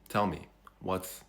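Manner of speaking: normal talking voice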